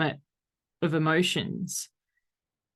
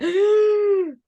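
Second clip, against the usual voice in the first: high, loud gasp of alarm; sharp gasp; 8 dB louder than usual. Shown as a demonstration. Reacting to a scary message with a gasp. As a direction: gasp in mock dread. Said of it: "And you're like".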